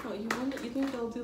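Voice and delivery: ordinary delivery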